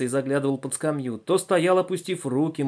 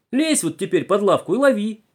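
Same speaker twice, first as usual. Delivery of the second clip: high, loud exasperated order; tearful scolding shout; 8 semitones higher, 6 dB louder than usual. A narrator's reading. Conductress orders boy to catch the viper, shouting tearfully. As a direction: order him under in wailing exasperation. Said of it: "закричала она плачущим голосом".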